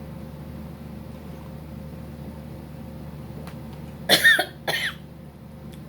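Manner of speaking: coughing, drink went down wrong; sputtering cough; choking cough. Real, not acted. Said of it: "that went down the wrong way".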